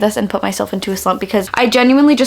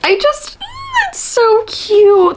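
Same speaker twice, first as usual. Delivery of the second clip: much higher squeal of delight; gushing squeal; 12 semitones higher, much slower than usual. Real, not acted. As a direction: squeal over how cute it is. Gushing over a sweet book quote.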